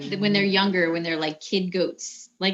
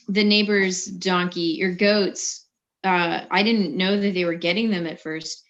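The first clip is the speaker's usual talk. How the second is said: ordinary talk, nothing marked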